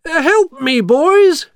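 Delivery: loud, high-pitched appeal; high, loud mayor voice; calling for help. A narrator's reading. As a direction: call the helpers over urgently. Mayor calls his helpers to pull.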